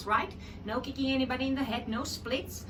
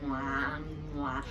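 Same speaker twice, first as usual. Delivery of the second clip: chewed sound; 9 semitones lower, 5 dB quieter, much slower than usual. Shown as a demonstration. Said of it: "chew on the sound".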